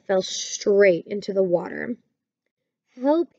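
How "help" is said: high, understated gasp for help; a mild gasped plea; gasping cry. A narrator's reading. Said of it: "he gasped and sputtered".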